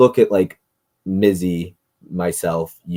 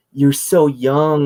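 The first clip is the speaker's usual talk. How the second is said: high, loud, slow fawning; gushing mock; 7 semitones higher, 7 dB louder, much slower than usual. Mocking people who coo at younger adults, slow gushing. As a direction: stretch it out like a gushing aunt. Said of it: "you look at a thirty-two-year-old and you go".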